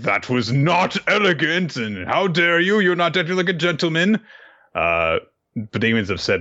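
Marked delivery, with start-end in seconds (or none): 0.0-4.2 put-on much higher, prim scolding old teacher; stern Henderson. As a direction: scold him like a fussy headmaster. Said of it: "Henderson is like".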